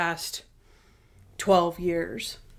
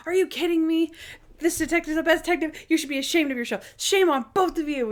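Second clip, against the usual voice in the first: indignant Natalie; 8 semitones higher than usual. Retold or imitated. Natalie defending Monk, retold.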